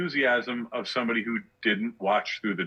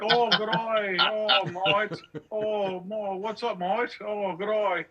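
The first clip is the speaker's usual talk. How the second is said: mocking fake Australian accent, pitched much higher; bad Australian accent; 10 semitones higher, slower than usual. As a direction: ham up a bogus Aussie accent to tease a friend. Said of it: "bullshit Australian accent".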